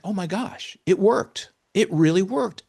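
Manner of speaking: high, elated retelling of a breakthrough; amazed excitement. Retold or imitated. Caregiver reliving the toilet method finally working, amazed excitement.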